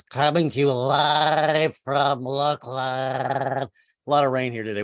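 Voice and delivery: slow, announcer-style delivery; stretched-out newscaster drawl; news anchor voice. Shown as a demonstration. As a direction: read it like a TV field reporter. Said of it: "I should be talking like this".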